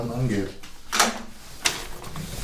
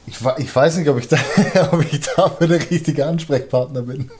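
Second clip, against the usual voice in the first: laughing; 4 semitones higher than usual. Real, not acted. Laughs at own modesty.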